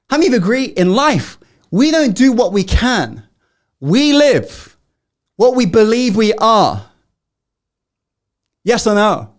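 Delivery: rally the audience with conviction; rousing preacher. Acted. Climactic motivational statement.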